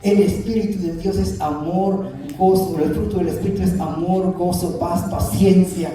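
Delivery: rising and falling pulpit cadence, pressing each point; a Spanish-language pastor preaching with conviction; fervent preaching. Acted. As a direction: preach it out to the congregation like a revival. Preaching to the congregation.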